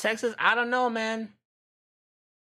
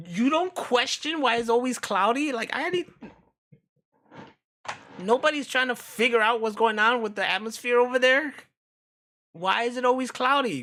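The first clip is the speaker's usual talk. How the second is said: higher, louder disbelieving rant; mock outrage at the cloudy weather, raised and high; incredulous rant; 7 semitones higher, 4 dB louder than usual. Real, not acted. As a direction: demand answers, baffled.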